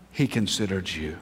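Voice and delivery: tender pulpit hush; hushed sermon. Acted. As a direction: lean in and speak it gently. Soft answering half.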